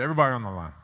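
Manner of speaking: loud coach's call to the line. Retold or imitated. Coach calling out at practice.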